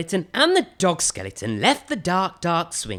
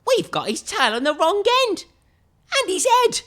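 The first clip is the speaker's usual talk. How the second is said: much higher squeaky-pitched dog voice; dog skeleton voice; 4 dB louder than usual. Acted. Dog skeleton points out his tail is wrong, very high voice. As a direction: complain as the muddled dog.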